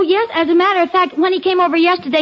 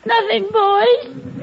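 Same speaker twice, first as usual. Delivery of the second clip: tearful; 7 semitones higher, much slower than usual. Acted. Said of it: "How come you're crying?".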